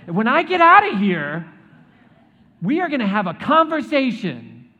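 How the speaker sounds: high, loud hammed-up threat; mock angry Daniel. Preacher imagines Daniel threatening the king, loud mock fury. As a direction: yell up at the king, half joking, fully fed up. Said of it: "I'd have been like".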